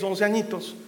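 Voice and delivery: usual speaking voice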